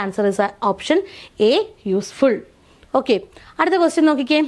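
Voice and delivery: ordinary talk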